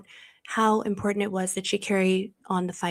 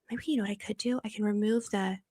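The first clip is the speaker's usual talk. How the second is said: usual speaking voice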